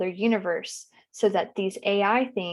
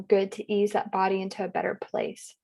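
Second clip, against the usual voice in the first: ordinary delivery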